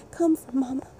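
usual speaking voice

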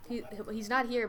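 ordinary talk, nothing marked

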